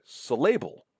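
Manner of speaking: SYLLABLE misstressed as sil-LAY-ble; wrong middle stress. Shown as a demonstration. Lecturer showing what nobody would say, stress wrongly on the middle. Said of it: "you're not going to say".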